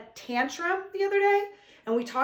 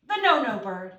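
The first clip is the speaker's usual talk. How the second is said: put-on slow, expressive read-aloud for kids; reading a poem to children; 4 semitones higher, 5 dB louder than usual. Shown as a demonstration. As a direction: read it slowly and with feeling to the class.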